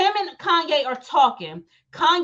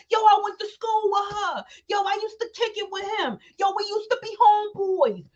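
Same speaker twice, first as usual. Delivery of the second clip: high, shouted hype bragging in a club; mock clout chaser; 7 semitones higher than usual. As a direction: shout it like a fake old friend. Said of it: "they wanna be in a club like".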